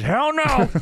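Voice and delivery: high mock outrage. Commentator answering the wrestler's question, high indignant cry. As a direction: imitate a commentator's emphatic refusal. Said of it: "And J R says".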